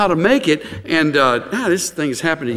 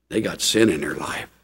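normal talking voice

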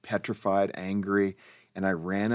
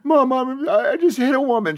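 retold tearful cry; 7 dB louder than usual. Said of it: "I'm in tears".

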